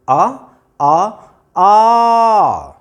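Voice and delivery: आ held long and stretched; drawn-out आ. Shown as a demonstration. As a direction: stretch आ out, the sound never changing. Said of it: "आप आ को बोलें". (आ को खींचकर लंबा बोलें)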